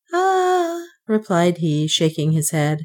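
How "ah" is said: banker's sigh. A narrator's reading. Banker sighs reply.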